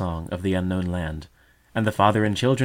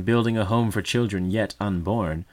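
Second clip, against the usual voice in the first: plain reading voice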